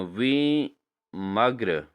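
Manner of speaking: drawn-out teaching read; slow, careful classroom word; very slow spelled word. Shown as a demonstration. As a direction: say it slowly for dictation. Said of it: "विरमग अशुद्ध है".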